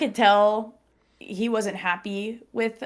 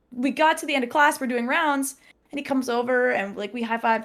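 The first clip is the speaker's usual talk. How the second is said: normal talking voice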